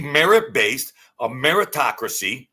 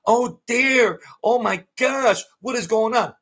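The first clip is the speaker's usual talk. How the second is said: much higher, fluttering mock alarm; mocking news anchor; 9 semitones higher than usual. Vlogger mocks a scared news anchor, high mock panic. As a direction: squeal it like a clutching-pearls anchor. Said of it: "they're like".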